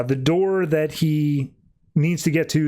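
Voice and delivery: usual speaking voice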